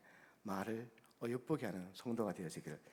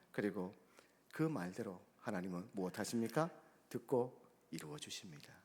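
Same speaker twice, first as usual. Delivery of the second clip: ordinary delivery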